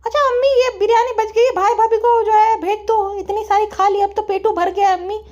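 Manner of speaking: high, insistent daughter voice; whiny daughter. Acted. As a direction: play the daughter pleading in a high pitch. (ज़िद्दी बेटी की ऊँची आवाज़)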